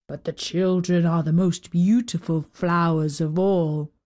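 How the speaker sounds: low, lightly voiced old giant. A narrator's reading. Old giant fond of the children, lightly voiced and low.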